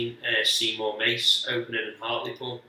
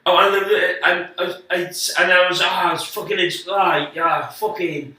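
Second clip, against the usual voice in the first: high, loud, panicky false starts; flustered stammering; 7 semitones higher, 6 dB louder than usual. Retold or imitated. Comic imitating his own failed stand-up act, flustered stammering. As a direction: blurt out broken scraps and never finish a sentence. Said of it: "you're not speaking. You're just going".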